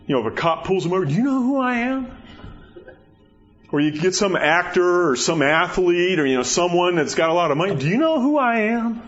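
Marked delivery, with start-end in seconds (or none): 1.1-2.2 high-pitched, indignant put-on voice; mock self-important official. Pulled-over official to a cop, mock self-important. 7.8-9.0 high, put-on big-shot voice; mock entitled celebrity. Rich star demanding special treatment, mock entitled.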